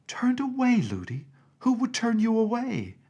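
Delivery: can't believe what she hears; shocked disbelief. A narrator's reading. Princess aghast that her nurse could be sent away, shocked.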